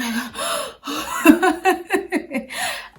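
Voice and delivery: imitated panic gasp. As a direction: show how she couldn't breathe. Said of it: "I couldn't breathe".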